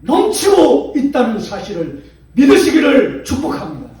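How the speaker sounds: loud, slow, soaring sermon climax; full-force preaching. Acted. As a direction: preach it out to the whole church. (힘찬 설교)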